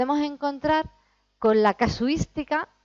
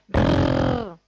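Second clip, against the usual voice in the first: a long rolling trill; trilled R demo; 3 dB louder than usual. Shown as a demonstration. Teacher demonstrating the rolled R for students. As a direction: roll the tongue, rrrr like a motorbike. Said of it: "tienes que hacer".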